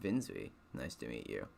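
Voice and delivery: low and soft, lifeless deadpan; corpse voice. Acted. Dream introducing himself to the reader in a low corpse voice. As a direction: sound like a talking corpse, low and quiet. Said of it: "giving him a corpse voice".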